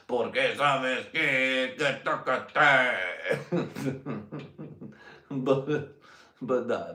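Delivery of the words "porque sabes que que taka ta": slow mock chant in Spanish.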